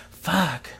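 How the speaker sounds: ordinary talk, nothing marked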